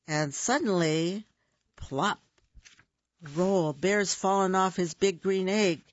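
ordinary delivery, nothing marked

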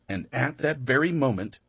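ordinary delivery, nothing marked

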